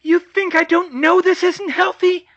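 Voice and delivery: shouted. Acted. Hermione bursting out at George, shouting. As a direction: explode at him all at once. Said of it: "she shouted all at once".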